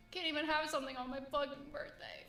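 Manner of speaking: usual speaking voice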